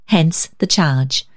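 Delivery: usual speaking voice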